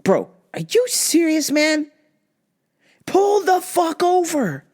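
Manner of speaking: high, slow Pull the fuck over; high exasperated yell. Retold or imitated. Driver yelling at stopped Jaguar, high exasperated yell. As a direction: demand it in disbelief.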